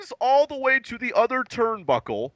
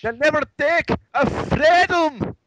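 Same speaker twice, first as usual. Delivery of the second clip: louder, higher, hammed-up Scottish accent; 8 semitones higher, 5 dB louder than usual. Co-host topping the host's Braveheart line, mock Scottish accent. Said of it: "my best Scottish accent".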